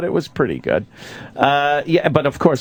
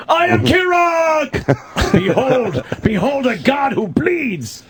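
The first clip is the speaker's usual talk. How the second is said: much higher, slow hammed-up shout; Shatner as Kirok; 10 semitones higher, much slower than usual. Imitating Shatner playing Kirok, a big theatrical shout. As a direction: ham it up like Shatner proclaiming godhood. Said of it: "and became Kirok".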